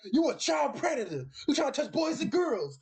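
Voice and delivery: high-pitched hammed-up public accusation; mock accusing vigilante. Imitating a vigilante yelling at a shopper in Walmart, high and hammed-up. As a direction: call out a child predator in the store, mocking.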